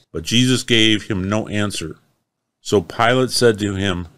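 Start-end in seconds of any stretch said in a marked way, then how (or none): none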